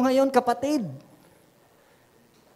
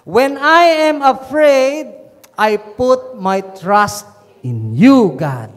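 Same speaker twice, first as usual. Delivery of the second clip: emphatic scripture repeat; 7 dB louder, much slower than usual. Real, not acted. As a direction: repeat the verse forcefully, stretching each phrase. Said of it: "Pwede bang ulitin natin tong psalm".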